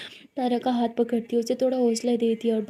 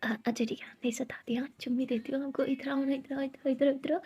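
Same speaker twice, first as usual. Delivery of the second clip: soft whisper coaxing; 7 dB quieter than usual. Acted. Siya coaxing Tara closer.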